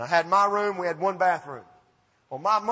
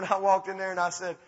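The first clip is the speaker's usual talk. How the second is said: usual speaking voice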